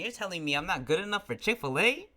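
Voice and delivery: offended disbelief climbing to the last word; incredulous. Real, not acted. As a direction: question it as if insulted. Venting about being rejected.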